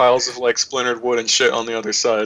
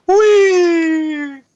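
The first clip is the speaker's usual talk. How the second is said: high, loud shout of glee; gleeful whee; 7 dB louder than usual. Acted. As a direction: squeal it like a kid on a slide. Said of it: "you go".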